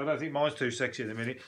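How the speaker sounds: ordinary talk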